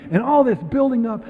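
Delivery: normal talking voice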